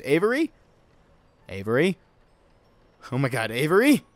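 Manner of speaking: loud worried calls of a name; calling out. Acted. Searching for Avery, loud and worried. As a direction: call out for the missing friend.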